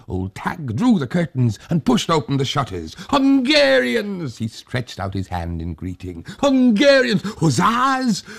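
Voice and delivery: high, loud overjoyed welcome; relieved old man. Acted. Old Tag welcoming the hussars, relieved overjoyed greeting. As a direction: greet them with relieved delight.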